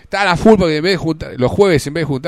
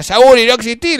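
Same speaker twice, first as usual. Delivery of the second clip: loud, higher taunting yell; mock shout; 6 semitones higher, 7 dB louder than usual. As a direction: shout him off from up the tree. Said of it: "le gritaba". (grito burlón y fuerte)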